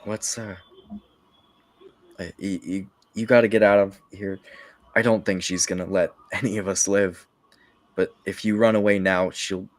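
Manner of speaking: fast, pressing plea; urgent dying knight. Acted. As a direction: hurry him out, breathless. Dying brother urges escape.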